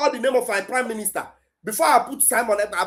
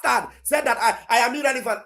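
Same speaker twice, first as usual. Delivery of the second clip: ordinary delivery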